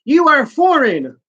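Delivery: high, pointed declaration; hammed-up solemn villain line; mock stern accusation. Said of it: "she says".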